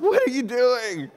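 high, drawn-out wail of disbelief; mock panicked protest. Retelling a kid as dad deflates the tube, mock panic. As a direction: imitate an alarmed kid, stretching the question.

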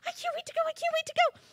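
a tiny grasshopper who can't wait for recess; Hopper's eager voice. Acted. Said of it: "Hopper whispers and goes".

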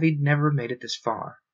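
plain reading voice

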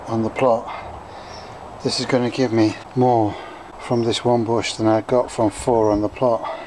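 usual speaking voice

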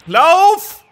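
very high, loud hammed-up cry of alarm; mock Goku cry. Imagining what Son Goku will say, hammed-up alarm. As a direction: overplay a panicked cartoon hero. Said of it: "wirst du sagen".

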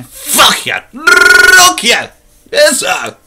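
hammed-up yelling fit; mock shout. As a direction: burst out yelling for effect. Sudden mock outburst.

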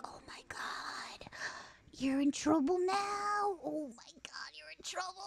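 slow, drawn-out stage whisper; awed whisper. Retold or imitated. Sibling seeing the cleaned pot, ominous slow whisper. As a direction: whisper it slowly, stretching the words. Retold gleeful warning, whispered.

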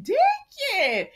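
high, loud Deacon; scolding call. Real, not acted. Mock scolding name call.

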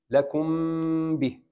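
لكم به with a long held nasal m before باء; ikhfa with ghunna. Shown as a demonstration. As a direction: hold the m of لكم softly before به. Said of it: "Just touch it". (لكم به بإخفاء وغنة ممدودة)

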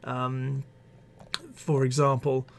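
ordinary delivery

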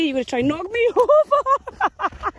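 high, slow words broken by a laugh. Real, not acted. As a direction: laugh as the dog tries to topple you. Dogs jumping at her.

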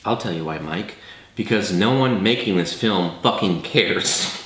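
low and quiet, gravely serious; deep solemn voice. Acted. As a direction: deliver it like a weary sage. Mock grave answer.

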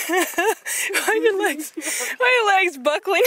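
slow, high mock disbelief at her wobbly legs; teasing whine. Real, not acted. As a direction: drag it out high, poking fun at a friend. Teasing a friend on the climb.

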